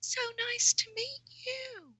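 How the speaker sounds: tiny, very high and soft voice; little voice. Retold or imitated. Coach imitating a big client's first greeting, tiny little voice. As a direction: shrink down to a small, high, quiet squeak. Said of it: "he had this little voice".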